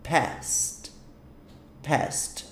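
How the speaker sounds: "past" in one syllable, said softly; soft "past" with a released final st; correct pronunciation. Shown as a demonstration. Teacher drilling the simple form, "past" as one syllable. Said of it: "É simplesmente".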